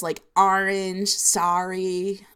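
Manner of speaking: ORANGE and SORRY with broad "ah" for O; O's like A's. Shown as a demonstration. Showing a New Jersey accent, O's said as A's. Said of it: "all your O's sound like A's".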